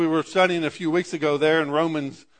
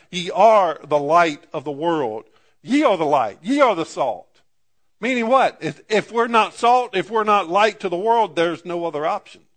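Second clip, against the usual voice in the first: preaching; 3 semitones higher, 4 dB louder than usual. Acted. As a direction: drive the point home to the church. Preacher rising to full force.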